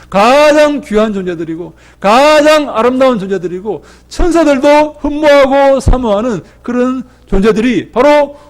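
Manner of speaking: high and loud, building fervour; preaching at full force. Acted. Pastor rousing the congregation about God's creation, fervent preaching. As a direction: preach it out to the whole hall.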